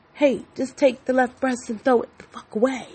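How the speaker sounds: a lightly voiced brash version of herself; blunt retold demand.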